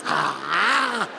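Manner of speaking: low animal chomping noise; imitated bark biting. Comic imitating tour guide biting bark, low animal noise. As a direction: chomp and growl 'ah' like an ape. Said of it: "bites the bark off the tree".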